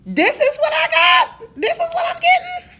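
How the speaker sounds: high, loud disbelieving yell through laughter; outraged and amused at a bad burger; incredulous laughing shout.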